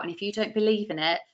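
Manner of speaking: normal talking voice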